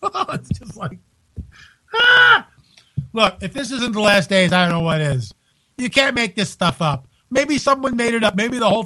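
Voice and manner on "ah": sudden high, loud "ah" of disbelief; exasperated yell. Real, not acted. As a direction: throw up your hands with a short loud cry. Exasperated at absurd headline.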